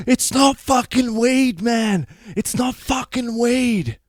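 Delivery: slow, very loud high-pitched scream; going psycho. Retold or imitated. Him raging at the police while held back, going psycho. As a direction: scream it out at the top of the range. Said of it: "going more psycho at this police officer".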